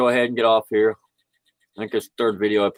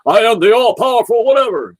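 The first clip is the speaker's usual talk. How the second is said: high, loud, grandiose proclamation; hammed-up boast of almighty power; mock all-powerful being; 12 semitones higher, 6 dB louder than usual. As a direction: proclaim it like a pompous deity, mockingly. Mocking a self-important claim.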